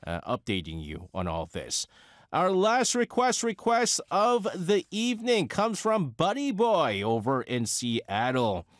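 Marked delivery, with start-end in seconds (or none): 2.3-8.7 high, drawn-out radio intro; radio announcer. Acted. Host introducing the evening's last request, slow high announcer voice. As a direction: announce it slowly, pitched up and big.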